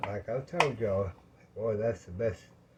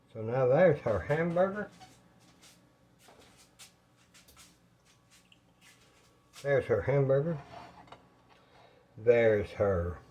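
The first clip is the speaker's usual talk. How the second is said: out of breath; 2 semitones higher than usual. Real, not acted. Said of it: "Oh, I'm out of breath, y'all".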